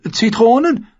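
high, hopeful questioning guess; eager boy hoping he's right. A narrator's reading. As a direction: offer it hopefully, rising at the end. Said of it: "meinte Pod hoffnungsfroh".

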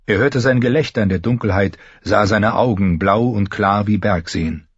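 ordinary delivery, nothing marked

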